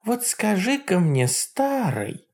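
high mocking old witch; Baba Yaga voice. A narrator's reading. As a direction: sneer like a jealous hag. Yaga speaks.

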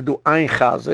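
ordinary delivery

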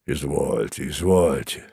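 low, soft grumble; grumbling. A narrator's reading. Mozes being pushed out into the corridor, grumbling. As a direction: grumble it under your breath, well down in pitch. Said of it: "бурчал Мозес".

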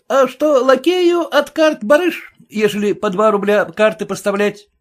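a hint of a fussy lackey. A narrator's reading. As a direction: play the self-assured servant defending his name. Lackey asks about card money.

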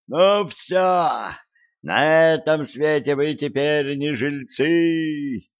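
high, slow threatening crow cry; fleeing crow's cry. A narrator's reading. The raven threatens them all as it flies away, crying out. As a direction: screech a threat while flying off. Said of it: "крик удирающего ворона".

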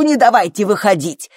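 loud, scornful echo, a little high; angry yelling. A narrator's reading. Mother mocking her labouring daughter's plea, angry yelling. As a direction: throw the words back at her in fury. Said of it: "завопила Фло".

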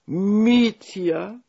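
high-pitched cry of sudden recognition; surprised exclamation. A narrator's reading. Elderly Nina recognizing Mitja, crying out in surprise. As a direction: gasp his name as an old woman, amazed. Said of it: "wykrzyknęła".

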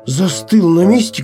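plain reading voice

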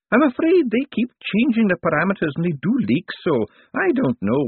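put-on a lost old wizard complaining; elderly wizard grumbling.

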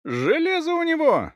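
very high-pitched alarmed yell; yelled warning. A narrator's reading. Thief warns comrades of a sword, yelling. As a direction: shout a warning to your gang. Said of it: "заорал ёж".

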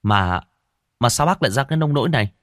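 ordinary delivery, nothing marked

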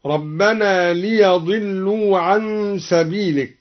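ليضلوا opened to liyadillu; ليضلوا بفتح الياء; ya with fatha. Shown as a demonstration. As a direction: say ليضلوا as liyadillu, with an a after the ya, slowly. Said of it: "قرأ الدوري بفتح الياء هكذا".

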